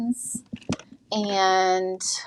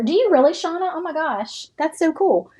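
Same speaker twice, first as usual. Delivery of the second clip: higher, delighted surprise at a viewer's news; excited; 8 semitones higher than usual. Real, not acted. Reading a viewer's comment about the same rug, excited. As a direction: gush a little, pleasantly surprised.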